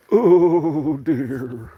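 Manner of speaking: high, far put-on oh dear; mock dainty oh dear. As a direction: sigh it like a prim lady. Mock sigh repeat of line 76.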